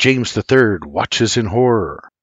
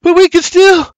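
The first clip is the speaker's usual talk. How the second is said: high, loud pleading shout; full-voice desperate objection; crying out; 7 dB louder than usual. Acted. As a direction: cry out in protest, cut short. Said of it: "James the third cries".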